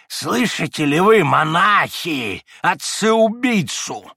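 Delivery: high, loud, slow accusing outburst; pouncing attack. A narrator's reading. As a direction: lunge at the monks with it. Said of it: "набросился Фёдор Павлович".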